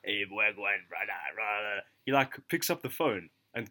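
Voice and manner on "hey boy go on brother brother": put-on London accent. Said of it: "that, like, [name] fucking accent".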